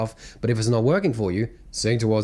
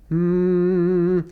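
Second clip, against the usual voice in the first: long, steady hum, pitched up; 7 semitones higher, 4 dB louder than usual. Shown as a demonstration. Voice coach showing students an exercise with mouth closed, steady hum. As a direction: hum with lips closed, holding one note. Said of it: "and just hum".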